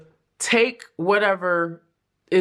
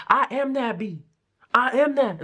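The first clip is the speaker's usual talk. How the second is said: sassy affirmation; 5 semitones higher than usual. Retold or imitated. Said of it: "Do somebody talk like that?".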